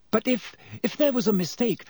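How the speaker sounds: timid plea. A narrator's reading. Hermione pleading timidly.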